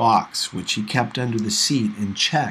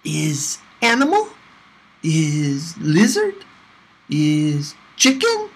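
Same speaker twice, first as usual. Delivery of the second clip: put-on pestering little boy; 4 semitones higher, 3 dB louder, slower than usual. Little boy teasing guesses.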